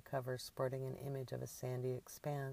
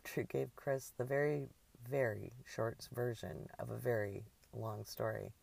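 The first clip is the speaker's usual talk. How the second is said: ordinary delivery, nothing marked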